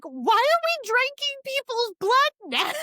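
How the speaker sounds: high, incredulous retold outburst; a squeaky mock disbelief. Friend recalls her shock at the blood plot. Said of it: "I was like".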